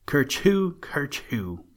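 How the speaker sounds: a big high sneeze then a smaller falling one. A narrator's reading. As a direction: sneeze it out, the second one fading. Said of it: "went the dear old gentleman rabbit".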